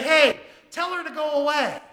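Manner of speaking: loud, high dismissive call; shouted retold demand; disciples' loud demand. Disciples' impatient demand, acted in retelling.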